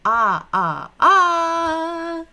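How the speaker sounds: loud, high, drawn-out а; loud vowel drill. Shown as a demonstration. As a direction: sing out а for the class to repeat. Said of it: "listen and repeat".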